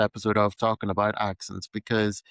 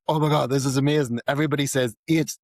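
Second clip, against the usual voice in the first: delighted amazement; 5 semitones higher, 3 dB louder, faster than usual. Retold or imitated. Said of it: "when I moved to Scotland, I was like".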